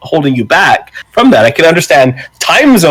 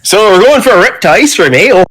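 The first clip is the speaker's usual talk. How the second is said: put-on Canadian accent; 8 semitones higher, faster than usual. Hamming up Canadian voice.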